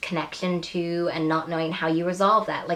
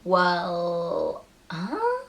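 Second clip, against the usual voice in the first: baffled, as if you missed something; an exaggerated confused double take; puzzled reaction. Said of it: "I'm kind of like".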